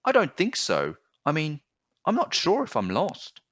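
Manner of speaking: put-on child voice, pitched high and hesitant; young boy. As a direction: sound like a puzzled small boy. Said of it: "the boy replied".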